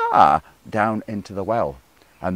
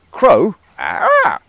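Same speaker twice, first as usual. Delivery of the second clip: crow caw; 8 semitones higher, 8 dB louder than usual. Acted. Crow cry opening story.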